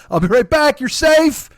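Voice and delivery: a hint of a calm agent promising to return; Clarice reassuring. Agent reassures trapped woman, lightly voiced calm promise. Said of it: "When fucking Clarice goes".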